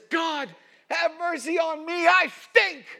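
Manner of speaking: high, loud, pleading shout; full-throated anguished plea; desperate cry for mercy. Retold or imitated. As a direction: cry out to God like a man at the end of himself. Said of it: "And he says".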